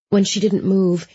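ordinary delivery, nothing marked

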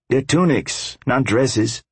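dry correction. Acted. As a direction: correct him, bone dry. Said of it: "Hush informed Viking dryly".

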